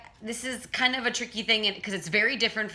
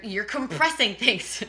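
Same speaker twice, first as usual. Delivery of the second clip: ordinary delivery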